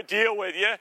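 ordinary delivery

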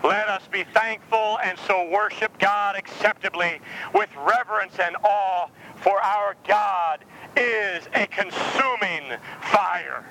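scripture reading. Acted. Reads scripture slowly.

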